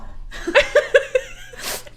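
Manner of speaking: shrill breathless cackle; high wheezing laugh. Real, not acted. Laughing about corn.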